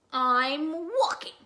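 slow, loud, drawn-out grumble; grumpy bird. Acted. Grumpy Bird answering Rabbit, loud drawn-out grumble. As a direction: stretch it out loudly in irritation.

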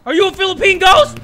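high, loud panicked questions; startled shouting. Real, not acted. Player confronting a ghost in the game, startled shouting.

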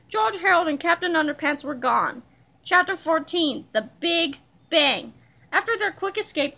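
plain reading voice

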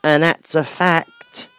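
put-on loud, slow, rude old Duchess; gruff Duchess. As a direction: bark it down at the girl like a bossy duchess.

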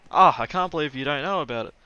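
loud, high-pitched hammed-up quarrel; play-acted fed-up outburst; mock exasperation. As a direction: argue both sides, building in pitch. Acting both sides of argument.

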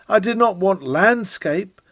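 usual speaking voice

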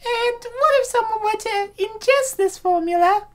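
put-on high girlish assistant voice; female assistant voice. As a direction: lift the voice high for the cute assistant. Assistant asks the doctor nervously.

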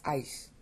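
"ice" ending in an S with a little sh in it; softened S. Shown as a demonstration. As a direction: soften the end of ice toward sh, like Castilian. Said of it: "a little bit of a sh sound".

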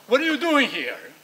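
put-on a child suddenly turning on the person at the door; angry outburst. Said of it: "And suddenly you go".

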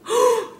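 high, loud surprised gasp; sharp gasp. Real, not acted. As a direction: gasp as the frog strikes. Frog catches moth.

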